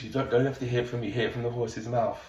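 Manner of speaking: ordinary talk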